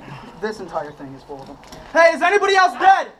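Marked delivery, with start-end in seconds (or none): 1.9-3.1 loud, high call to teammates; shouting. Real, not acted. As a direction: yell it across the field. Calling out across the field.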